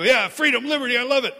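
high, loud eager agreement; excited churchgoer answering the pastor; mock enthusiastic fan. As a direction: gush like a fan. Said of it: "And they go".

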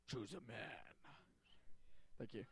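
low, deep Goliath voice, held back in volume. Shown as a demonstration. Showing a helper how Goliath should sound, deep voice. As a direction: drop it deep and quiet for the giant. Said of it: "deep, like, deep voice".

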